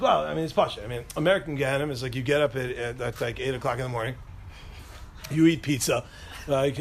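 usual speaking voice

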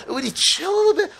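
very high-pitched mock disbelief; incredulous scolding. Speaker chiding those who skip opportunities, incredulous. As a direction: squeal the question incredulously.

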